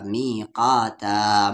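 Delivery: मी काता very slow with long open vowels; stopping form. Shown as a demonstration. Teacher showing the pause form, मी काता drawn out. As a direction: stretch मी and the aa's of काता. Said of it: "रुकने की सूरत में".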